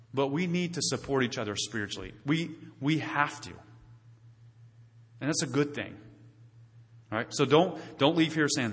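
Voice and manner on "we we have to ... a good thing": normal talking voice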